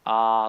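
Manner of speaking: Ah said with the lips wide open; open-lipped Ah. Shown as a demonstration. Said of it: "when you open the lips like".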